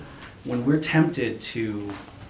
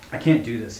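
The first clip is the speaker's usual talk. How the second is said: ordinary delivery, nothing marked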